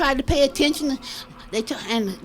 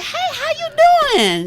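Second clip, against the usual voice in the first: put-on chirpy old lady greeting; 13 semitones higher, 5 dB louder than usual. Friend greeting in retold joke.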